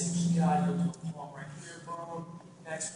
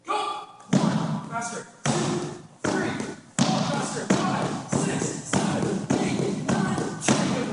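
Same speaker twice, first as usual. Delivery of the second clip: barking each number much louder; shouted count; 7 semitones higher, 11 dB louder, much slower than usual. Real, not acted. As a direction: drive the class through the reps. Coach counting reps, urging faster.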